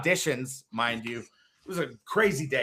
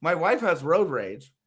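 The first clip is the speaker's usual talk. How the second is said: ordinary talk, nothing marked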